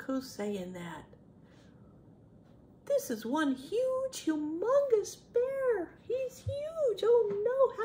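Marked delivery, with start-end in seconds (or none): 2.9-7.8 high, stretched storytime awe; exaggerated high-pitched wonder for children; amazed. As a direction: marvel at the enormous size. Marvels at enormous bear.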